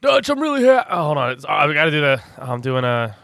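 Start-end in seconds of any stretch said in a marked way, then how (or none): 0.0-0.9 higher, louder attempt at John's voice; John Marston impression. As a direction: try on John's rasp and give up. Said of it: "John Marston's hard for me to do".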